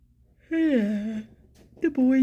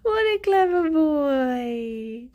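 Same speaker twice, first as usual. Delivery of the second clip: slow, high, sing-song praise; squeaky praising a dog; 5 semitones higher, much slower than usual. Real, not acted. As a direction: drag it out sweetly for the pet. Praising a pet.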